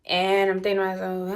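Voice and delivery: normal talking voice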